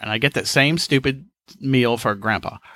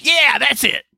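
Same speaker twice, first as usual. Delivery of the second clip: put-on high old-man voice, a bit louder; 13 semitones higher, 4 dB louder, slower than usual. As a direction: grandpa confirming the sandwich. Grandpa agreeing.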